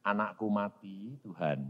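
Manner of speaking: low, quiet lament; retold grieving prayer. Father tells God his child died, low grief. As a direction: sink low and soft, addressing God.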